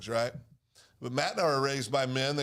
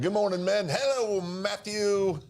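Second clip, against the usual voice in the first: higher, sing-out hello; big greeting; 6 semitones higher, slower than usual. Real, not acted. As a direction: hail a friend arriving, pitched up. Greeting Matt as he appears.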